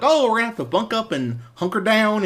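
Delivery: high-pitched country twang; country accent. Acted. Joking reviewer voices the in-laws bunking up, country accent. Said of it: "country, uh, accent there".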